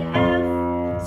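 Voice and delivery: ordinary talk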